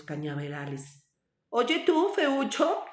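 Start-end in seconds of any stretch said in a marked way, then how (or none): 1.5-2.8 high-pitched taunting goose; mocking. A narrator's reading. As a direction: call out teasingly in a raised pitch. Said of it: "le dijeron en tono de burla". (tono de burla agudo)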